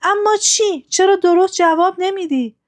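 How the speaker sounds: out of patience, push him to answer; narrated queen losing her patience; impatient. A narrator's reading. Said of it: "صبرش تموم شده بود".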